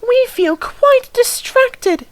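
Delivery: high, strained coach voice; flustered coaches. A narrator's reading. Coaches worn out by endless trains, fretting high and flustered. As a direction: fret like overworked coaches. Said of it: "they protested".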